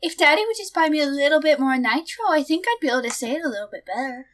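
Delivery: wheedling Discord kitten begging for Nitro. Pretend. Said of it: "Do it in the Discord kitten voice".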